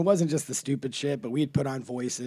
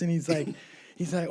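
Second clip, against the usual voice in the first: usual speaking voice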